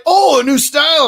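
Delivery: high, loud gushing friend. Retold or imitated. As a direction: gush like an excited friend. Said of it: "They're like".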